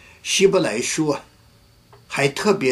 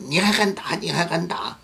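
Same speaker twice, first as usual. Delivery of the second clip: indignant retort; 6 semitones higher than usual. Retold or imitated. Wife confronting husband in fight.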